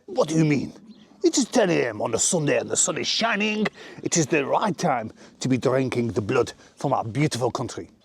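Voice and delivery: hammed-up French accent. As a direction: grandly defend the wine in a French accent. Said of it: "French accent".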